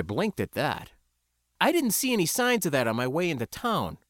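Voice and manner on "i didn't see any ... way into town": plain reading voice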